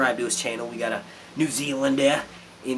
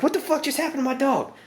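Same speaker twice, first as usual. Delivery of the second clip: shocked. Retold or imitated. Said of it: "I was like".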